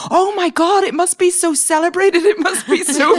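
breathless fangirl squeal with a laugh; gushing excitement. Retold or imitated. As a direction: rave like a wide-eyed newcomer. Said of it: "'Cause I was like".

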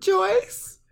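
high mom call. Retold or imitated. Calling out name, mom voice.

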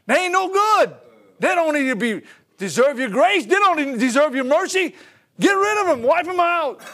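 loud, high, indignant demand for judgment; playing Jonah sulking, shout down fire on the city; angry Jonah. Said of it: "He said".

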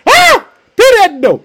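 high, loud excited squeal; overjoyed scream. Acted. Preacher acting out servant girl at the door, overjoyed shriek. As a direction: shriek it, bursting with news. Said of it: "she was so full of excitement".

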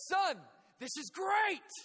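dad shouting with delight from a hole; cheerful dad shouting. Retold or imitated. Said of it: "He goes".

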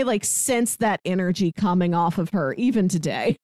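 ordinary delivery

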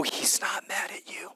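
soft, intimate whisper. Acted. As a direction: lean in and whisper the good news. Preacher whispers the message.

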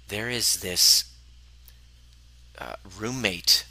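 ordinary talk, nothing marked

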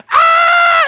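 very high, very loud mock shriek; imitated shriek. Wife opening the door on fallen husband, imitated shriek. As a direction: mimic the wife's piercing scream at full volume.

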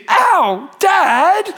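put-on high, loud child's yelp; hurt toddler. Son calls from the car floor, high hurt yelp. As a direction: cry out like a small boy who just fell.

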